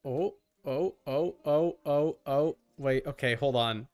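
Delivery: usual speaking voice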